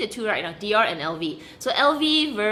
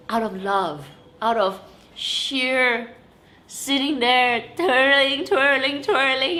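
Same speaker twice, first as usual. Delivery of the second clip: dreamy gush; 3 semitones higher, 4 dB louder, slower than usual. Acted. Dreamy emphasis on handmade love.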